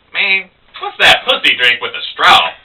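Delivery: high-pitched mocking disbelief; scornful barroom jab; local guy. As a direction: needle the show-off about the drink. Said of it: "the local guy".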